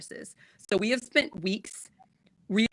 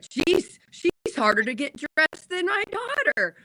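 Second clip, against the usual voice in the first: quoting husband; 8 semitones higher, slower than usual. Retold or imitated. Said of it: "he's like".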